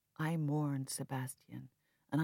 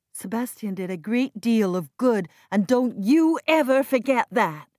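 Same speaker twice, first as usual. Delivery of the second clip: loud, raised voice; heated argument; 4 semitones higher, 10 dB louder than usual. Acted. Sister and brother arguing over the foundation, loud. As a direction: press the point angrily. Quarrel continues.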